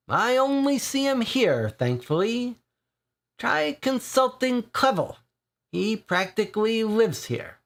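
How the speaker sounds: put-on grinning Irish regular ribbing the boys; jovial Irish accent with a grin. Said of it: "with an Irish accent".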